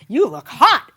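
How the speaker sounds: loud, swooping retold compliment; retold admiring remark. Someone in the Disneyland line to her, seemingly admiring. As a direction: sound like an admiring onlooker, imitated.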